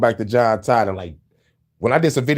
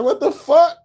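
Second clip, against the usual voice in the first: shocked what the fuck; 13 semitones higher, 3 dB louder than usual. Retold or imitated. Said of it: "I'm like".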